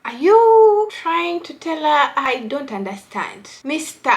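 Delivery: ordinary talk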